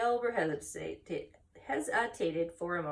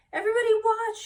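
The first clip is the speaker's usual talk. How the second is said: much higher and louder, full of hope; excited cry; 8 dB louder, faster than usual. Acted. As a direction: announce it to everyone before the leap. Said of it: "he cried".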